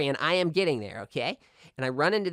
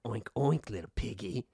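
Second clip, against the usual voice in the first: low, soft creepy pig voice; 6 semitones lower, 9 dB quieter than usual. Retold or imitated. Ghost pig taunting the trapped boy, low and creepy. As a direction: menace him softly like a ghost pig. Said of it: "and he goes".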